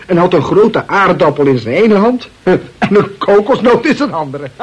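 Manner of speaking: breaking up with laughter mid-sentence; can't keep a straight face, laugh through it. Acted. Feeding the inspector a silly description, laughing.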